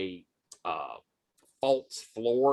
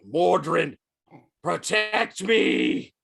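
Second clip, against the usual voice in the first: loud, raised villain's cry, drawn out; desperate invocation; 5 semitones higher, 4 dB louder, slower than usual. Acted. Staggered gnoll clutching his ankh calls for protection, loud invocation. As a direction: call out to a dark power, stretching the last words.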